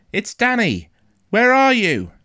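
high, loud urgent call; shout. A narrator's reading. Boy running towards the sound of his father, shouting. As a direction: yell towards a faint voice. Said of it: "I shouted".

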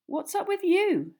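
high, loud puzzled question; surprised. A narrator's reading. Girl asking her sulking friend what is wrong, surprised. Hermione surprised, asks Ron.

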